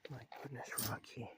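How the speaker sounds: hushed, breathy my goodness; whisper. Real, not acted. To cat Rocky outside at night, hushed whisper. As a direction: whisper it so only the cat hears.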